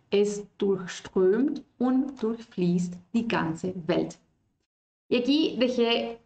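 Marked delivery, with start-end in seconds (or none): 0.1-4.2 Es durchströmt und durchfließt die ganze Welt slow and even; slow repeat. Shown as a demonstration. Teacher repeating for the class, slow. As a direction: repeat the sentence slowly for practice. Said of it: "La siguiente frase dice".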